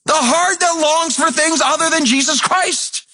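high, shouted pulpit preaching; fiery preacher at full volume; full-force preaching. Acted.

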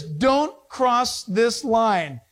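slow, word-by-word warning; spaced-out words, like a posted order; warning sign. Acted. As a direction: read the sign out slowly and firmly.

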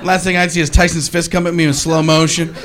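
ordinary delivery

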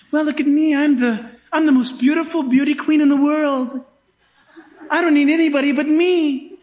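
a touch of a preening beauty queen, laughing; lightly mocking vain woman. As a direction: show off like a self-adoring beauty queen. Mock beauty queen quoted.

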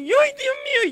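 put-on desperate old woman. Comadre back for the third time, very high desperate wail. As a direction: let it break out like real despair.

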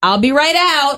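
high lilting call through a door. Acted. Occupant answering a bathroom knock, calling out. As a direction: reassure the knocker from inside. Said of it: "here are the popular responses for how you should respond".

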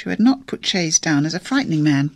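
ordinary delivery, nothing marked

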